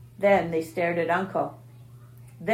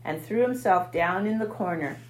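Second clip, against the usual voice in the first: ordinary delivery, nothing marked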